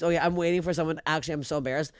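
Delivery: ordinary talk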